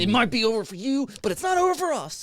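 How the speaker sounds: high, loud sinister taunt; horror host. Acted. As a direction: tease like a gleeful haunt monster. Menacing reply about haunt season.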